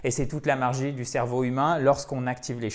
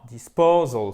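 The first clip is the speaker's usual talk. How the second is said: DISPOSAL with PO punched louder; accent tonique; 2 semitones lower, 6 dB louder, slower than usual. Shown as a demonstration. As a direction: lean hard on PO in "Disposal". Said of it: "Faut vraiment bien faire cet accent tonique". (Disposal, accent appuyé sur PO)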